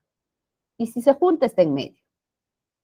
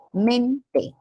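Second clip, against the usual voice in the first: "mente" split as MEN, te, men higher; stressed first syllable; 4 semitones higher, 3 dB quieter than usual. Shown as a demonstration. Teacher shows the suffix's tonic syllable, stressed. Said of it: "también tiene su sílaba tónica".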